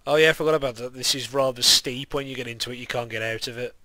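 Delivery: ordinary talk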